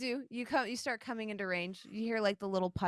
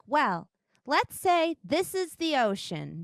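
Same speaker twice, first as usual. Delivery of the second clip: higher, louder, slow goddess voice; turtle goddess; 5 semitones higher, 6 dB louder than usual. Acted. Turtle goddess drawing a diagram, higher slow voice. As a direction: lay it out slowly, pitched up, as an explainer. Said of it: "and she's like".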